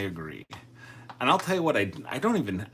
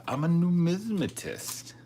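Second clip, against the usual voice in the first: high, loud, proudly declared; mock proud; 9 semitones higher, 5 dB louder than usual. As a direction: announce it like a grand title. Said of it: "with the proper tone of voice".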